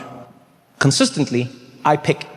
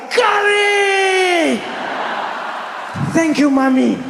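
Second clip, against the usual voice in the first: little [name] thrilled by his first curry bite; ecstatic shout; much slower than usual. Retold or imitated. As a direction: shout it like a kid who just found heaven. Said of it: "I was like".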